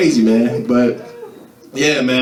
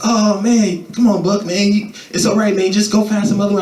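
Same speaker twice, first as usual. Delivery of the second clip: mock consoling friends; 6 semitones higher than usual. Friends comforting, high mock voices.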